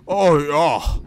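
loud, high hammed-up cheer; excited. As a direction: belt it out excitedly.

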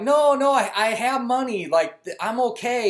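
flustered protest. Retold or imitated. Embarrassed refusal of lady's offer.

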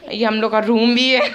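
breaks into real laughter; laughing. Real, not acted. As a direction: laugh through the line. Bursts into laughter. (हंसी फूट पड़ना)

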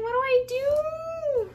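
high, drawn-out whine; helpless wail. Real, not acted. Painter stuck with bad purple, helpless wail. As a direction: wail it loudly, stretching the last word.

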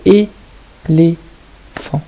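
L'éléphant said slowly with every syllable clear; drawn out for the children; teacher pronouncing a word slowly for the class; slow, careful word. Shown as a demonstration. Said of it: "c'est quoi la syllabe qui manque au début".

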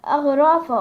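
أغرافة read out loud and clear, each syllable shown; loud model reading of أغرافة; Coptic word demo. Shown as a demonstration. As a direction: teach the word أغرافة, projecting it. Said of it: "علينا كلمة اسمها كتاب".

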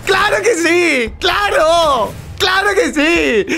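high, slow triumphant yells; real joy, yelling up high; excited shouting. Real, not acted. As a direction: shout the win out, stretching every word. Celebrating a win in game.

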